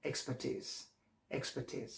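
এক্সপার্টিজ softly with a "teez" ending; repeat-after-me model. Shown as a demonstration. Teacher asking learners to repeat, soft drill. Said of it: "repeat করেন আমার সাথে".

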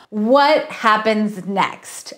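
plain reading voice

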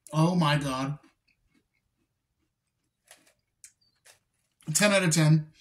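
talking between bites; chewing while talking. Real, not acted. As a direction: mouth partly full of snack. Seen 2: Holds snack sticks, bites them repeatedly while talking; no reference frames show eating.

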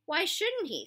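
sharp, high-pitched retort; irritable challenge; snapped. A narrator's reading. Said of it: "snapped X-Ray".

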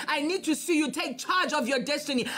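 usual speaking voice

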